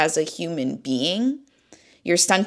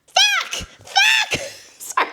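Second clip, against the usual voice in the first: real crying, voice shooting very high; breaking into tears; much slower than usual. Real, not acted. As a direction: swear helplessly as the tears take over. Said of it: "I didn't know I was gonna cry".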